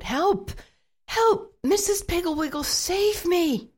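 shouting for help. A narrator's reading. Boy hanging upside down yells for help, panicked shout. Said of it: "Then he began to shout".

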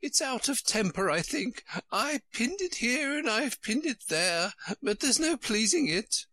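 put-on high, fluttery old queen voice; a high, flustered royal voice. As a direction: play the dithering queen, high and fussy. Queen's voice held by reader.

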